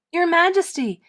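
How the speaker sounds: loud high urgent calling; boy shouting to the dazed king. Acted. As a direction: call out to get the king's attention. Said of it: "shouted Eric".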